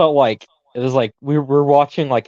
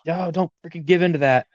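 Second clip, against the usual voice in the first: higher-pitched imitated cry, no real volume; half-hearted retold yell, pitched up; mock yell; 6 semitones higher than usual. Fan retelling a hero's plea to his friends, mild mock yell. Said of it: "like, yelling out".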